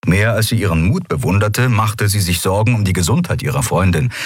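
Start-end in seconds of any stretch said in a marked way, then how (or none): none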